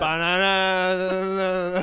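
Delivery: very slow, loud stretched word; drawn-out. Acted. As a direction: stretch it out absurdly.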